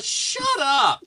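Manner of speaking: high, exasperated cut-off; comic outburst at a caller; mock angry. Fed-up man cutting off telemarketer, mock angry yelp. Snapping back at mocked caller.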